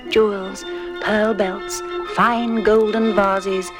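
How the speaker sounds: ordinary delivery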